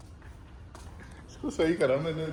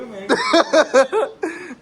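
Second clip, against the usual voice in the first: real loud laughing; 14 semitones higher, 8 dB louder than usual. Real, not acted. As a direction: burst of high laughter. Laughing at friend. (ज़ोर से हँसी)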